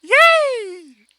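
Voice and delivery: very high, loud fan scream; screaming fan joy; ecstatic scream. Retold or imitated. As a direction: squeal with delight. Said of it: "I'm just like".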